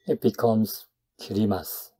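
ordinary delivery

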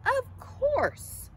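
high, loud, self-satisfied reply; conceited butterfly voice; Katisha. A narrator's reading. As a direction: toss it off grandly from on high. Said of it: "replied Katisha".

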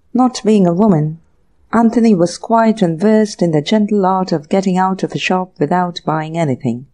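ordinary delivery, nothing marked

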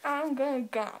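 ordinary talk

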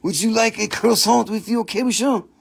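a hint of a French accent, playful; mock French. Seller offering croissants to buyers in a mild mock French accent. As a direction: lightly put on French waiter accent. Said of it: "Definitely French".

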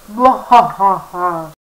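slow mock villain laugh; hammed-up evil laugh; evil cackle. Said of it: "Oh, and I'm evil".

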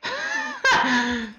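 high nervous laughter; scared laugh. Real, not acted. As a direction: break into laughter from sheer fright. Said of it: "I'm laughing because I'm scared".

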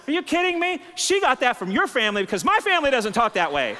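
high-pitched accusing husband; hammed-up marital blame; mock indignant outburst. Acted quarrel blaming his wife's family.

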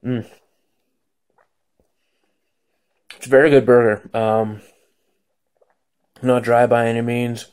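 talking with mouth full; muffled mid-bite speech; chewing food. Real, not acted. Seen 0: Bites burger at -5, chews with closed mouth, burger held up.

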